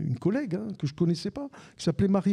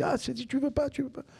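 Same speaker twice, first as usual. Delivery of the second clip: agacement imité; 10 semitones higher than usual. Retold or imitated. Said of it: "Elle s'est énervée".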